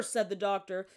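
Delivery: usual speaking voice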